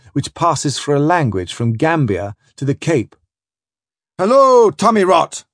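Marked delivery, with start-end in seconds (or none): 4.2-5.4 high, loud captain's hail; calling out. Acted. Spring hailing the crew canoe, calling out. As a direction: cry it out across the water. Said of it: "cries Spring".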